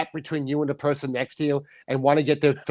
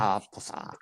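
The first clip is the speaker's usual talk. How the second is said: catchphrase; 8 semitones lower, 10 dB quieter, slower than usual. Pretend. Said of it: "you forgot to say the thing".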